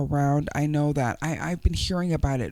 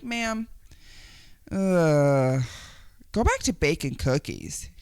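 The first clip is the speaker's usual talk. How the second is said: ordinary delivery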